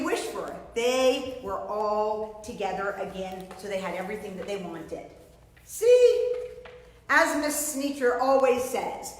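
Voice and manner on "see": high and loud see; excited see. Real, not acted. Teacher's excited point.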